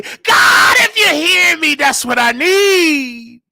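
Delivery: preacher's cry to God. Real, not acted. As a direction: wail the prayer, dragging it out. Crying out to God.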